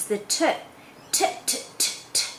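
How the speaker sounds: "t" tapped crisply again and again; repeated t sound. Shown as a demonstration. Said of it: "for instance".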